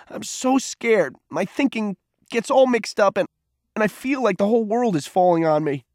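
high, anguished, overwrought wail; frightened cry. A narrator's reading. Sobbing boy admits his world is falling, crying high. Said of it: "cried Peter".

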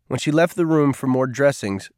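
plain reading voice

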